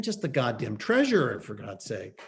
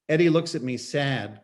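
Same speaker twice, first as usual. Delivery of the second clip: usual speaking voice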